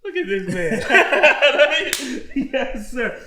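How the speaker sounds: loud laugh, pitched high; real burst of laughter. Real, not acted. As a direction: crack up at your guest. Laughing at guest.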